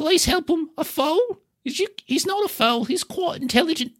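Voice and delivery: high, fretful hobbit voice; sad hobbit owner. Acted. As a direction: fuss over the poor pony. Hobbit pleads and objects.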